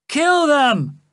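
high, loud battle cry; a henchman shouting for the kill; cried out. A narrator's reading. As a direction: yell the order to attack. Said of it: "cried a voice".

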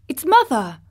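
joyful cry. Acted. Dickon greeting his mother halfway, delighted. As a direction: call it out in delight. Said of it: "Dickon said again".

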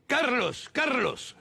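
high calling out of a name, with a laugh. Retold or imitated. Swallowing his pride, calling out to Diego. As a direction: call his name up high across the room. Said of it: "y decirle".

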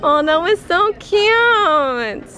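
high, long-drawn coo of delight. Real, not acted. Mother melting over a child saying hi to Dad, cooing.

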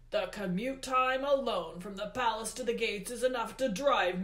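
loud, heavily stressed rant; Hades, aggrieved. A narrator's reading. Hades rants to Percy Jackson about expenses, aggrieved. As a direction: complain at full voice like an overburdened god.

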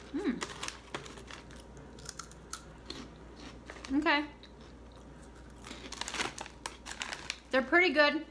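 mumbled between bites; talking while eating crunchy snack; chewing with mouth full. Real, not acted. Seen 1: Repeatedly bites and chews snack sticks throughout the labelled words.

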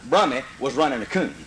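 ordinary talk, nothing marked

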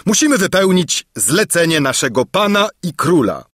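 high, firm declaration; emphatic. A narrator's reading. Captain putting his master's errand first, emphatic. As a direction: state the duty pointedly. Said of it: "rzekł dobitnie".

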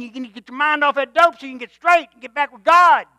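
loud, insistent preaching; full-force revival sermon; fiery preaching. Acted. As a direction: drive it home to the sinners.

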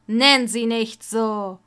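loud, slow, forceful command; angry loud rebuke; angry outburst. Acted. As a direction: snap it out, wounded and furious. Snape defends Lily angrily.

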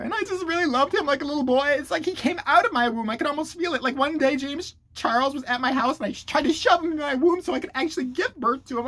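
high-pitched, overwrought parody confession; mock victim. Influencer gushing over the boy like her baby, mock weepy. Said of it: "do I look like a victim enough".

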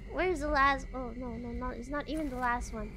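normal talking voice

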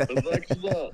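loud, high laughing speech. Real, not acted. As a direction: laugh through the words. Prank revealed, laughing.

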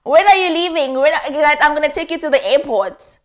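high, loud incredulous repeat; indignant loud mimicry of the boyfriend; outraged mock echo. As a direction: shout it back in disbelief. Outraged echo of his words.

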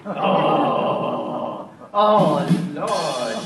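pained groan at a pun; mock groan. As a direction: groan like the joke hurt. Groaning at a bad joke.

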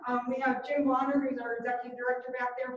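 usual speaking voice

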